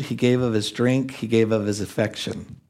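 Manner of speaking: ordinary talk, nothing marked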